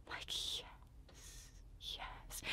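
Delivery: breathless whispered "yes, yes" of relief. Retold or imitated. To herself after the doctor's promise, a relieved whisper. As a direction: whisper "yes, yes" as if the pain is finally ending.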